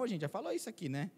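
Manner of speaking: ordinary talk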